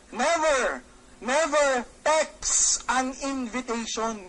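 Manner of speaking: both nevers hit hard and slow; a slow firm warning to never text; stress on never. Shown as a demonstration. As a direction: lean on never, drag it out. Seminar rule, stressed warning.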